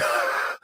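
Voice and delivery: wheezy, voiceless mock laugh; imitated voiceless laugh. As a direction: laugh with no voice, just air. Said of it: "if there was a laugh, I'd be like".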